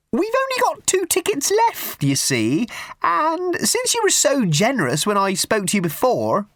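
put-on a prim, simpering old charity lady pushing tickets; high twittering church-fete lady; raffle woman.

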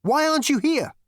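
very high, loud question; agitated demand. A narrator's reading. Zaphod challenging the unseen voice, agitated.

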